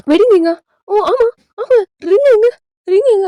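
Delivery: high-pitched, flustered voice of someone hunting for a lost thing; flustered searching. Acted. Wife realises her wedding ring is missing, flustered and high. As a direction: act out a rising panic, pitched well up.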